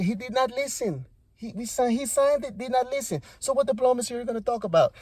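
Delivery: mock exasperated leader making his case; Zelensky. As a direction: press the grievance, building in pitch. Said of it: "Zelensky's like".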